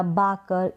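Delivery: बाकर with a long open "aa" first; mispronunciation demo. Shown as a demonstration. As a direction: show the wrong reading बाकर. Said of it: "इस तरीके से नहीं पढ़ेंगे".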